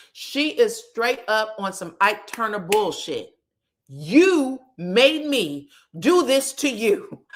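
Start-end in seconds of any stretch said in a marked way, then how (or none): 3.9-7.2 slow, louder, higher-pitched blame; hammed-up finger-pointing; mock accusing. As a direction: draw out the accusation theatrically. Retold quarrel, blaming partner.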